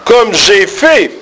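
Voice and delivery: comme, j'ai and fait each hit high and slow; three words stressed. Shown as a demonstration. Teacher showing the class to stress comme j'ai fait word by word. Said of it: "les trois mots".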